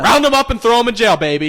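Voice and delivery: loud, high rallying shout; rowdy rallying cry. Pretend. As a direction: holler it like a hype man. Riled-up jokey call to jail them.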